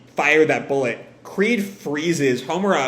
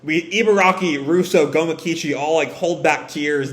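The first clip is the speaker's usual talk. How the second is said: ordinary talk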